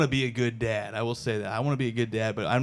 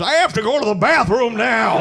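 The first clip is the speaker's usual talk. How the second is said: higher, louder deadpan announcement; matter-of-fact old man; 8 semitones higher, 5 dB louder than usual. Retold or imitated. Old YouTube dad leaving the grill, high blunt announcement. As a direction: announce it bluntly, mid-video. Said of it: "he just goes".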